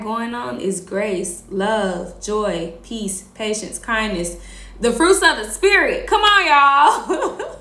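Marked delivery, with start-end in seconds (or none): 4.8-7.5 loud, high cheer breaking into laughter; rally the crowd, voice soaring; rousing exclamation. Real, not acted.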